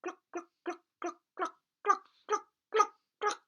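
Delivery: hen clucking. Acted. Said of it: "went cluck".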